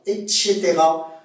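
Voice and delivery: "et cetera" with a sh sound, et-chétéra; Québécois pronunciation. Shown as a demonstration. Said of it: "en québécois".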